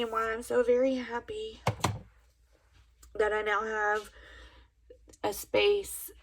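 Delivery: tearful, voice breaking while talking; crying through the words; blubbering. Real, not acted. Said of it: "I'm sorry I'm crying".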